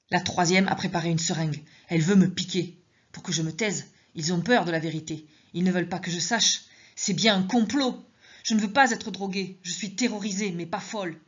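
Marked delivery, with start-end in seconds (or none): none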